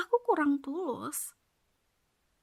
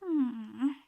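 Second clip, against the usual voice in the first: ordinary talk, nothing marked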